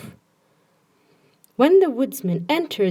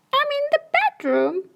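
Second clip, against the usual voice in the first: much higher, squeaky wolf pretending to be granny. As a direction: squeak it like a wolf faking sweetness. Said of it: "called the wolf in a squeaky voice".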